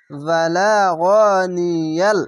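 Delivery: বালাগনিয়াল chanted slowly, syllables held; slow recitation. Shown as a demonstration. Teacher modelling বালাগনিয়াল slowly. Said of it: "উচ্চারণ হবে".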